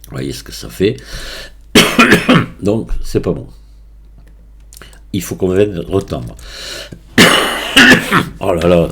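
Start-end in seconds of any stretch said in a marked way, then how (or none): 1.7-2.6 sharp dry cough; a real short cough. Real, not acted. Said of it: "Ça va pas me foutre la paix". 7.2-8.3 breathy dry cough; a real rasping cough. Real, not acted. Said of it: "Ça va pas me foutre la paix".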